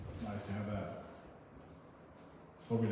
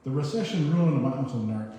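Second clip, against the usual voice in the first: loud, full declamation stressing "ruined"; poem reading; 4 semitones higher, 9 dB louder than usual. Acted. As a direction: boom out the opening line of the poem. Reads the poem properly.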